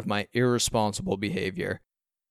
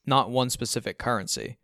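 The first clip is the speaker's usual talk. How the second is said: ordinary delivery, nothing marked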